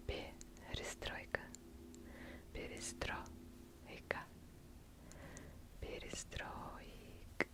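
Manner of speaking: unvoiced word-by-word whisper, very soft; ASMR whisper. ASMR host repeating Russian word perestroika for listeners, soft whisper. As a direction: whisper each repetition softly and unhurriedly.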